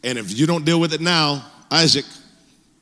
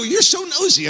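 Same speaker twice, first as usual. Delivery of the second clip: teasing; 13 semitones higher than usual. Retold or imitated. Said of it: "I go".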